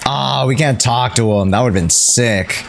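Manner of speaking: ordinary talk, nothing marked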